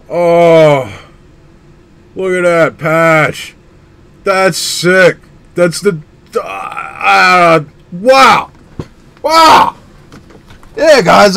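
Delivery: high, loud gasps of wonder; amazed. Real, not acted. Collector showing viewers the patch, awestruck. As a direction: marvel out loud at every detail.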